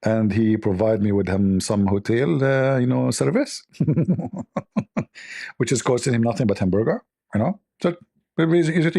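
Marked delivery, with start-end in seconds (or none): 3.8-5.0 chuckle. Real, not acted. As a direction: sly chuckle at own joke. Laughs at own hotel joke.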